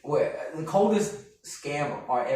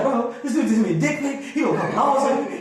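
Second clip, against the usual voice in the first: higher-pitched, mildly panicked retelling; retold panic; 7 semitones higher than usual. Comic waking his brother over a police threat, mock panic. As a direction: replay being scared, pitch pushed up. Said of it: "I made no sense 'cause I'm scared".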